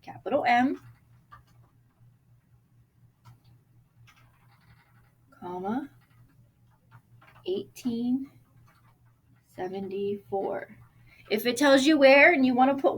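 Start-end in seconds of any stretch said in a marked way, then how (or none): 0.0-10.8 dictating. Shown as a demonstration. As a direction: read it out softly as you write. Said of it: "So I'm going to put".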